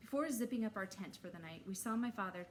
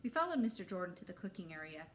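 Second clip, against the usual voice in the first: ordinary delivery, nothing marked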